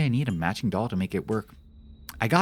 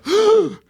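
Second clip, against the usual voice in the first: loud high gasp of waking fright; 9 dB louder than usual. Retold or imitated. Streamer showing how he wakes up scared, a high gasp. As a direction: gasp as if jolting awake scared. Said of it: "instantly go".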